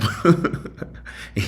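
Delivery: a quick high laugh; short high laugh. Real, not acted. Host laughing at his own joke, short high laugh. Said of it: "laughing to keep from crying".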